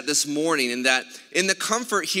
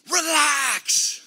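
high, strained holler with a falling end; mock sideline shout, pitched way up; imitated coach yelling; 12 semitones higher than usual. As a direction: yell it like a tense coach from the bench. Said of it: "he starts yelling from the bench".

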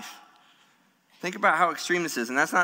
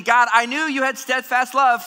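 raised, louder bitter accusation; angrily; 7 semitones higher, 5 dB louder than usual. Acted. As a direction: throw the word love back at God in anger. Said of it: "He is angrily saying".

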